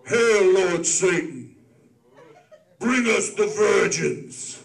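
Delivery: slow, loud ritual intonation; cult leader voice. Pretend. Mock satanic ritual leader.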